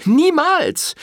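very high, loud outburst; woman refusing the priest's advice, shouting defiantly; defiant cry. Acted. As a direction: fling the refusal at him.

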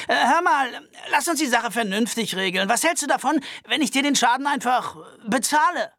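high-pitched nervous Albert voice. Acted. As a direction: complain fussily and fast.

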